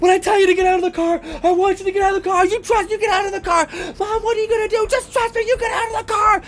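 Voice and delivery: very high, rapid, panicked orders; frantic urging. Acted. Lori orders family out of the car, frantic. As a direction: rush the words out high and desperate.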